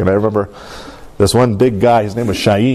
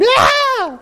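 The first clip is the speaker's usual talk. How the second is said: sudden high startled yell; mom's scream. Retold or imitated. Mom shrieks at a rat running by. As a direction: shriek like you just saw a rat. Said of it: "my mom screamed".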